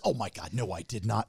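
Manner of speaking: low-pitched imitation of wife protesting; wife's denial. Wife denying to husband she started it, low protest. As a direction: drop the pitch to voice her denial. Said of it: "And she goes".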